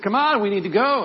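high, loud, impatient urging; urgent pleading. Acted. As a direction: press him to get moving. Disciples urge Jesus to leave.

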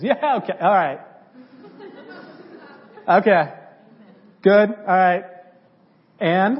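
mock him like you don't buy it; a friend humouring you, loud and unconvinced; sceptical buddy. Friend's doubtful reply, acted.

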